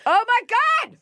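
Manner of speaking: a gasping shriek of disbelief; shocked. Real, not acted. Host shrieking in disbelief at the story. Reacting to fat shaming story.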